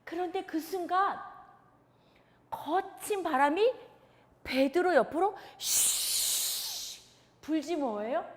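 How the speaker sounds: ordinary talk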